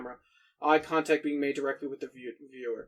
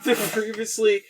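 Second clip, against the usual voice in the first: laughing; 7 semitones higher, 4 dB louder, slower than usual. Real, not acted.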